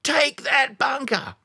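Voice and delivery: high, slow barked battlefield order; screaming order. Retold or imitated. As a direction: yell the command at the troops. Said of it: "Tom Hanks just screams".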